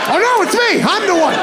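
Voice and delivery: high-pitched mock panicked realization. Big American realizing he'll be beaten, high mock panic. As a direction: squeak it in sudden dread. Sudden panic at being target.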